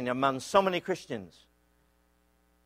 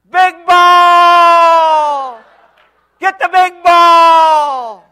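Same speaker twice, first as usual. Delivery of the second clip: high, loud, long drawn-out scream; 13 semitones higher, 15 dB louder, much slower than usual. Retold or imitated. Injured player screaming to his team, high, loud and stretched out. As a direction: scream it out while flying backwards. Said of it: "he's screaming out".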